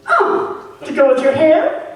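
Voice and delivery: high, deadpan teasing jab; zinger. Retold or imitated. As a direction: land the dig sweetly. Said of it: "Laura says".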